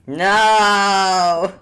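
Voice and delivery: long, loud, high drawn-out wail; mock anguished cry. Game master caught out by gacha joke, mock anguished cry.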